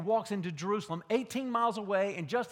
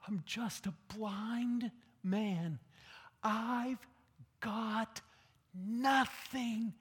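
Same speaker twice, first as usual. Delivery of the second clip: humble plea; 2 semitones higher, slower than usual. Acted. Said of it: "the man down here going".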